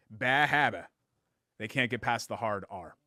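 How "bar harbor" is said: Boston accent. Shown as a demonstration. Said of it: "Ba habba?".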